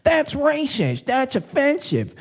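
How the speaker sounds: mock the offended critics in a high, slow whine; mocking whiner.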